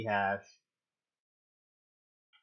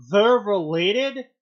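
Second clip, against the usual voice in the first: loud, slow, higher disbelief; shocked yell; 6 semitones higher, 9 dB louder, slower than usual. Retold or imitated. Reading Steve's shock, loud slow disbelieving cry. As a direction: gasp out the question in shock. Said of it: "Steve yelled in shock".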